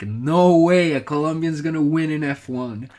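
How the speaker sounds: granddad's excited yell, retold half-full; retold excited scream. Retelling granddad cheering a Colombian's F one win, mock excited scream. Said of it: "my granddad screaming his lungs off".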